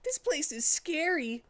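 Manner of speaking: very high, nervous; a little fox spooked; frightened Tails. Acted. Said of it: "said Tails".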